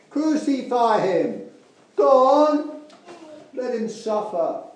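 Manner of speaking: high, loud jeering crowd yell, drawn out; angry mob shouting. Acted. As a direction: shout it like a mob baying for blood. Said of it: "they said".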